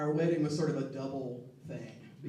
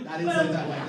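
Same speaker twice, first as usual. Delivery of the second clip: higher and louder, laughing through the words; 11 semitones higher, 9 dB louder than usual. Real, not acted. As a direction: break into real laughter at your own joke. Jokes, then laughs at own line.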